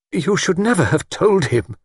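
high-pitched, emphatic reproach; reproachful. A narrator's reading. Older woman blames the couple, reproachful. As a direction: reprove them for it. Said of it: "in her reproachful glance".

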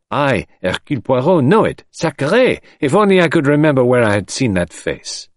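put-on high, vexed French-accented boast; French detective. A narrator's reading. Detective straining to remember a face, vexed and emphatic. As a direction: declare it grandly, then fret, pitch up.

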